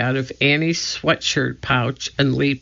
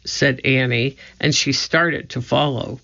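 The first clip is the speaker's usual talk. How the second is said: ordinary delivery, nothing marked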